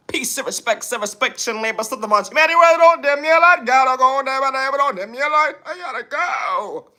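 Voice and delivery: hammed-up high-pitched trash talk; sassy persona voice. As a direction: taunt the commenters in a high cartoon diva voice. Persona voice sign-off.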